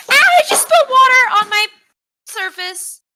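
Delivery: very high, very loud cry of alarm; real yelp of panic; real panicked shriek. Real, not acted. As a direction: shriek in dismay at a sudden spill. Real water spill on the Surface.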